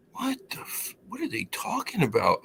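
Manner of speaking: hushed start, then high, soft bewilderment; baffled disbelief. Retold or imitated. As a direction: re-enact being baffled, breaking off into a puzzled question. Baffled reaction to the voicemail.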